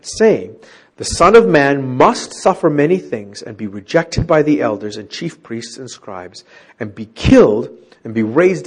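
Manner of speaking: formal Bible reading voice; scripture reading. Acted. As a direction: read the verse out to the class. Reads scripture aloud.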